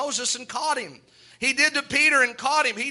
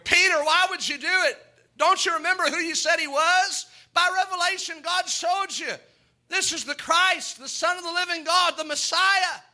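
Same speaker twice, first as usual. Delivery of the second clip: higher, pleading self-rebuke as Peter; Peter's anguished inner scolding, raised and pressing; Peter scolding himself; 8 semitones higher than usual. Acted. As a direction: berate yourself for the denial, voice up high.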